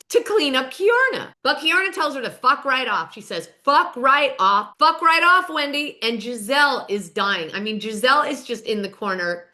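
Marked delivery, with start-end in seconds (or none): 3.6-6.0 drawn out, then louder and higher; emphatic retold telling-off, building; mock telling-off. Keiarna tells Wendy off, mock-angry and emphatic.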